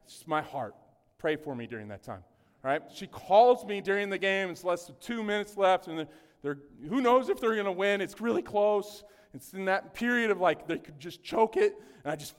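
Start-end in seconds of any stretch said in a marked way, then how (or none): none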